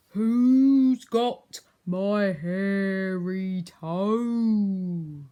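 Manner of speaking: ghostly cry. Acted. Ghost seeking its toe, slow haunting wail. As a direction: call it from far away. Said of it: "a voice crying".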